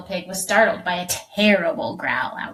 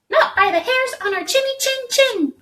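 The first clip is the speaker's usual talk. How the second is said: very high, squealing pigs; crying piglets; 4 dB louder than usual. A narrator's reading. Frightened pigs crying out to the wolf. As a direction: cry out high and scared. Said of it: "cried the pigs".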